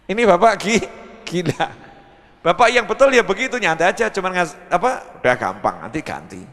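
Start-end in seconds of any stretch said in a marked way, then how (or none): none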